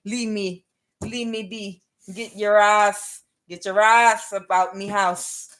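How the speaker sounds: mock Jamaican patois, stretched on the vowels; attempted Jamaican accent. Jamaican telling someone to back off, attempted Jamaican accent. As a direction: put on a rough Jamaican accent. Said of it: "I'm thinking of doing an accent".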